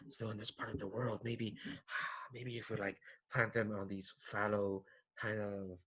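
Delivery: soft, low, quick muttering under the breath; muttering to himself. Acted. Antlered youth mutters to himself about the diggers, quietly. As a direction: mumble it to yourself, half lost in thought. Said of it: "muttering to himself about".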